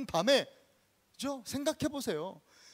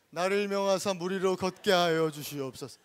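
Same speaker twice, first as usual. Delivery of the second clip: even, measured scripture recital; formal, dignified plea; 3 semitones lower than usual. Shown as a demonstration. Preacher showing how Peter did not speak, composed formal plea. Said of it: "이렇게 안 하셨다니까".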